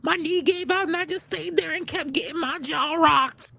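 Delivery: high granny voice; old lady voice. Retold or imitated. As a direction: answer innocently in a high granny voice. Janice answers again.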